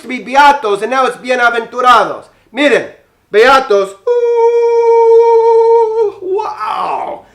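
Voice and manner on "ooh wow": high, loud ooh then a gasped wow; amazed. Acted. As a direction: play it up like a wonder just appeared. Amazed at new word.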